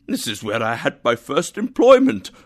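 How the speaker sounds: loud, climbing burst of delight; excited recognition. Acted. Scrooge recognises his first workplace, excited. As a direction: exclaim it, thrilled.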